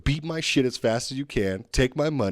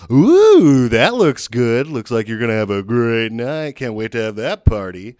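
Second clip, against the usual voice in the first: loud, gushing cashier with a slow drawn-out line; mock chatty cashier; 9 dB louder, slower than usual. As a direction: gush at the groceries like an overfriendly clerk. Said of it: "grab each one of my groceries and go".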